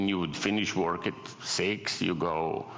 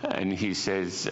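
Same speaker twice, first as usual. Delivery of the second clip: usual speaking voice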